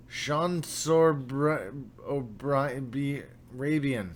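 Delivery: slow, halting stab at an unfamiliar name; stumbling slowly through a hard name; trying to pronounce it. Real, not acted. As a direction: sound out the name piece by piece. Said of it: "I'm trying".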